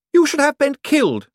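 high, loud haughty woman; Poochie's voice. Acted. To Cosmo, a greedy high-pitched rich woman.

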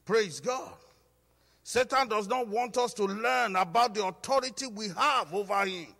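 loud, high, driving sermon voice; preaching at full force. Acted. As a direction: preach it out to the whole church. Preacher builds again.

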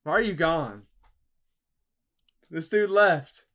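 ordinary talk

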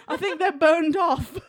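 high, slow voice, laughing; laughing Cockney. Retold or imitated. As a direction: laugh through the words, Cockney. Voice of the singed Londoner.

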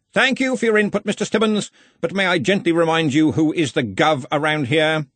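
high, measured rebuke; pointed politeness. Acted. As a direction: remind a subordinate sweetly who is boss. Boss gently putting down subordinate.

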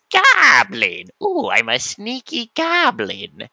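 silly goblin voice, pitch swooping high, words stretched out; sneaky goblin. Pretend. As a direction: play a sneaky cartoon goblin, drawn out and hammy. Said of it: "doing a goblin voice".

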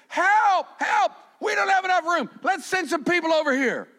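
high, loud happy panic; joyful pretend alarm shout; mock cry for help. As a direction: shout like the pews are overflowing. Church crying out for help.

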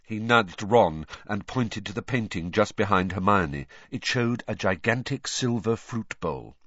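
ordinary delivery, nothing marked

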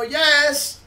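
high, loud calling "yes"; called-out answer. Retold or imitated. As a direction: answer loudly to someone calling you from afar. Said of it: "she calls my name".

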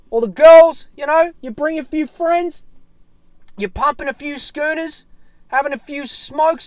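high, loud, revved-up listing; hyped up. Real, not acted. Fan listing fight day joys to mates, hyped. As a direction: keep the party hype rolling.

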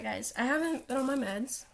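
ordinary talk

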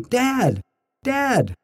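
a high, light imitation of a small boy calling Dad; child calling out. Son calling to his dad from the audience, high mild child's call. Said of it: "John, shouting".

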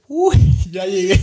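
a burst of relieved laughter on arrival; relieved laughing exclaim. Retold or imitated. Waking at the border, he laughs out his relief.

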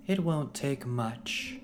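slow, high and silky villainess; silken voice. Acted. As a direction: purr it slowly like a sly villain. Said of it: "came a silken voice from behind".

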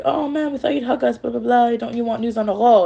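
high-pitched imitation of wheedling; mock pleading. Men coaxing Henry with news, high mock plea.

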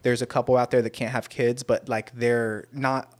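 usual speaking voice

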